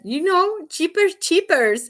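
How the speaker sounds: high, insistent mock Spanish accent; husband's bad Spanish accent. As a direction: say "Cheapers Cheapers" slowly, with CH for J and no R, in a Spanish accent. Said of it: "accent and he just can't pronounce stuff right".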